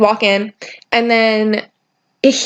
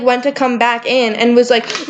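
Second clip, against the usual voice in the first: normal talking voice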